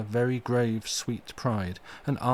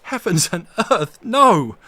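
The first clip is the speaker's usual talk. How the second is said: loud, much higher outraged cry; furious shout of refusal; storm of passion; 10 semitones higher, 13 dB louder, slower than usual. Acted. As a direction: explode in horror. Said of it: "cried Arthur in a storm of passion".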